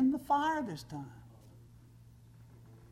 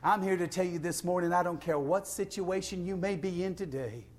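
ordinary talk

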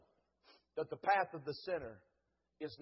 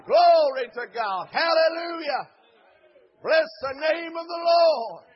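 loud, driving revival sermon, higher than conversation; full-force preaching; 8 semitones higher, 17 dB louder than usual. Real, not acted. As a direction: preach it with gasped "uh" between phrases, building to shouted praise.

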